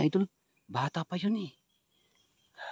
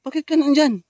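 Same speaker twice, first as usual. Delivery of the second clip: loud, higher shout of alarm; shouting at a stranger who appeared; startled shout; 10 semitones higher, 10 dB louder than usual. Real, not acted. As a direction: yell in surprise at the figure.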